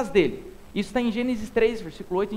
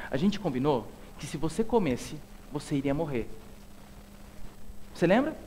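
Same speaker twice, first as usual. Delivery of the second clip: low, soft and patient; gentle God; 7 semitones lower, 7 dB quieter than usual. Acted. God reminds Adam gently, low soft voice. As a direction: quietly confront with care.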